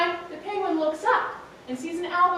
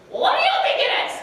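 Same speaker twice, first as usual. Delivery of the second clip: very high, loud, fast bird voice; high screechy albatross; 7 dB louder, much faster than usual. A narrator's reading. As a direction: rattle it off like a seabird. Albatross voice in story.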